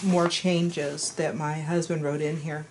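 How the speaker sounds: ordinary delivery, nothing marked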